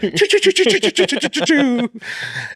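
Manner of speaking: rapid high mouth-made gunshots; stuttering pew-pew machine gun noise; imitated gunfire. Man pretending to shoot while running, rapid gun noises.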